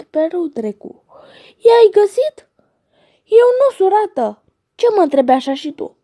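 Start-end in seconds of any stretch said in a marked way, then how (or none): 1.6-2.5 eager question. Acted. Goose eagerly asks the other. 3.2-6.0 goose voice. Acted. Goose answers high and loud.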